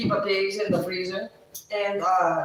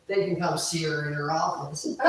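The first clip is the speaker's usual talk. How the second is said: normal talking voice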